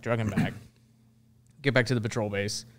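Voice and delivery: usual speaking voice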